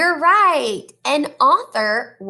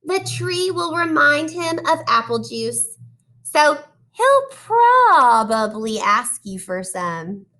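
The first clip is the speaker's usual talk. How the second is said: put-on reading to children; 2 semitones higher than usual. Teacher reading a picture book to her class, animated storytelling. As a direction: draw the kids into each page.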